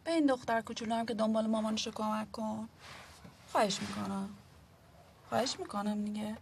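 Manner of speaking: soft pleading. Acted. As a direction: plead gently for help. Woman begs for help.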